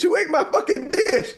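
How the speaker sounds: half-laugh the furious question; laughing mock outrage. Asking his family who ate his dish, laughing mock outrage. I was so freaking mad.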